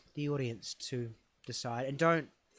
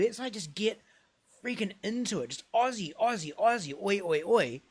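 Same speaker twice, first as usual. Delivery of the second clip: higher, rhythmic chant at the end; acted rallying cry; 7 semitones higher than usual. Immigrant urging his friend to get into it, rousing. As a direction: rev a mate up into a chant. Character mimics Aussie supporters' chant.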